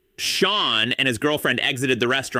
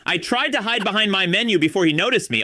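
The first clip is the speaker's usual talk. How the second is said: usual speaking voice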